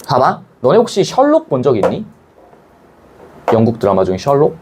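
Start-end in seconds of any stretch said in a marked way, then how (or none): none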